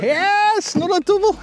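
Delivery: high-pitched cry of triumph; excited cheer. Real, not acted. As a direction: cheer a fresh catch, pitch soaring. Angler cheering a double catch.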